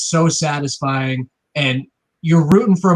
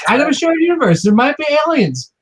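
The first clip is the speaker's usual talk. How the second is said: excited high voice; 13 semitones higher, 4 dB louder than usual. Acted. Excited alien tease.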